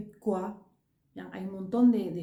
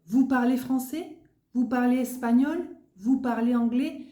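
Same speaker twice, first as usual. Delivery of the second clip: each question rises at the end, said louder; rising question tune; 4 semitones higher, 5 dB louder than usual. Shown as a demonstration. Teacher demonstrating the rise to students, louder rising tune. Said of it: "et comme tu entends, ça monte à la fin".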